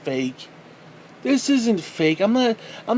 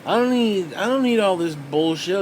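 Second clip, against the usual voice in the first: normal talking voice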